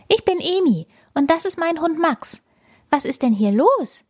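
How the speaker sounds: put-on high young girl introducing herself; girl's voice. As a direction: speak like a curious five-year-old.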